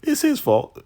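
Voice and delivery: high, loud, hurried mock panic on "It's his fault"; quick blame. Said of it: "she was quick".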